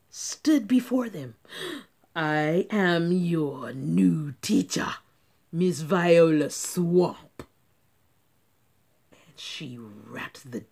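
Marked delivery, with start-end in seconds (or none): 2.2-7.4 slow, loud, menacing witch teacher; stern teacher. Acted. Mean substitute introducing herself to the class. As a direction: lay down your name like a threat.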